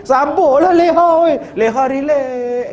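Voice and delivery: high, loud pleading comfort; consoling friend, high and loud. Acted. Friend hugging the widow begs her to stay calm, high and loud. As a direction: soothe the stunned widow urgently.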